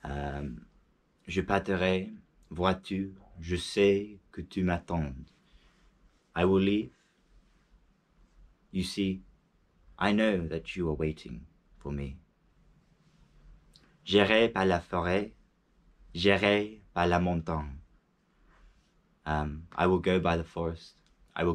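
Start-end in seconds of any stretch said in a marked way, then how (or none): 1.3-5.3 poem recital. Shown as a demonstration. Reading the poem out line by line before translating, solemn. 14.1-17.8 poem recital. Shown as a demonstration. Reading the poem out line by line before translating, solemn.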